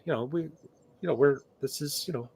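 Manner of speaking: put-on safe-space tenderness; mock sensitive. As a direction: feign delicate concern. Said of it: "Uh, that was sarcasm".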